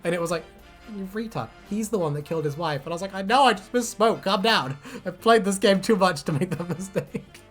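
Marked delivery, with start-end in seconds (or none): none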